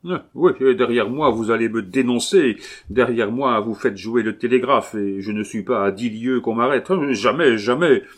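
frightened murderer shouting his refusal; panicked outburst. A narrator's reading. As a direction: fling back every fear. Murderer fearing denunciation.

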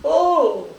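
broken cries rising to a high, loud wail; dying cry of pain. Acted. Strong seized by the machine's steel claws, agonized dying cries. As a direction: cry out in agony. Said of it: "exhalait son suprême cri de douleur".

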